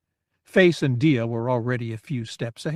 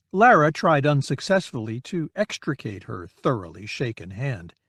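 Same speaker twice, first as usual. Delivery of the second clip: ordinary delivery, nothing marked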